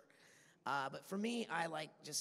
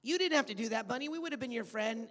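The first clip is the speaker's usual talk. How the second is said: voicing Norbert; 9 semitones higher, 7 dB louder than usual. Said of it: "Norbert says to him".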